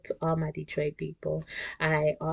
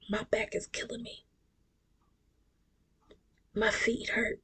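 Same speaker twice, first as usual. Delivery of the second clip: whispered complaint; 5 semitones higher, 6 dB quieter than usual. Real, not acted. Complaining about aching feet.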